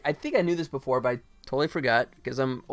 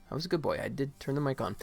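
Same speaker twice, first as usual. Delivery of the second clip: whispered aside; 8 dB quieter, faster than usual. Real, not acted. Streamer reassuring himself the mic is on, whispered. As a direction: whisper it to yourself. Muttering to self about mic.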